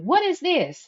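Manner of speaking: sister's mad question. Retold or imitated. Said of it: "my sister was mad".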